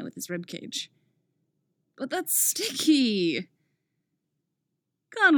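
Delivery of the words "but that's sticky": high, drawn-out complaint; whiny protest. A narrator's reading. Squirming character protests in story.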